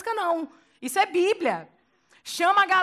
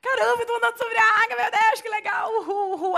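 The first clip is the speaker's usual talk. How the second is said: much higher, louder whooping joy; mock elation; 9 semitones higher, 6 dB louder than usual. Pedro walking on water, cheering with hammed-up joy. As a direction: cheer like a kid on a ride.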